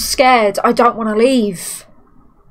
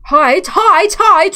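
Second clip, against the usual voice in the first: panicked; 6 semitones higher, 6 dB louder, slower than usual. Real, not acted. Panic when the monster appears.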